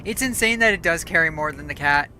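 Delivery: ordinary talk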